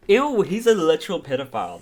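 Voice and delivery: high, loud ew of disgust; disgusted outcry. Real, not acted. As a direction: cry out, repulsed. Disgusted reaction to creepy caller.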